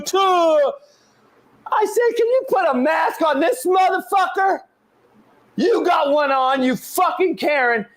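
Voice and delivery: high-pitched yelling rant, shouting at the mother in the story; real yelling rant, pitch way up; furious rant. Real, not acted. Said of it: "it wasn't part of the rant".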